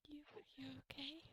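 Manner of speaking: hushed, hesitant whisper of concern. Acted. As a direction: whisper it, checking gently on a friend. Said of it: "Luke looks concerned over at James".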